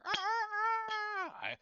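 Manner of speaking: very high, sustained crow; rooster crowing. Acted. Knight keeps crowing to bait the monster, high rooster call. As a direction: crow out like a cockerel. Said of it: "you could hear the crowing".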